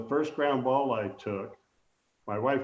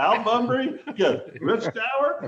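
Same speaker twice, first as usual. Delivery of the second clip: incredulous protest; 9 semitones higher, 3 dB louder than usual. Retold or imitated. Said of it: "And I said".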